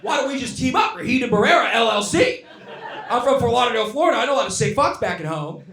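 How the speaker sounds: loud, high, manic rant at the blackmailer; mock rant. Comic yelling his business pitch to the blackmailer, loud and high. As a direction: yell the offer like a crazed pitch. Ranting at the sextortionist.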